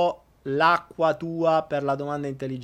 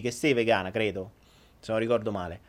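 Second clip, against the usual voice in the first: ordinary delivery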